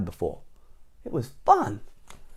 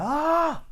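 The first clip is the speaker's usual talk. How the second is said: high, drawn-out mock cry; mimed big "ah". Shown as a demonstration. Reader imitating Mister Noisy's big gestures, mimed cry. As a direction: sing out a high, long "ah". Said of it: "his big gestures here, like".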